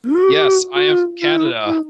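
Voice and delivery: high, loud whiny nerd shout; angry nerd voice. Pretend. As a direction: squeal it like an outraged nerd. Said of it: "That's my angry nerd voice".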